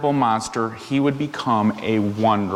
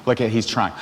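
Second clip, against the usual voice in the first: usual speaking voice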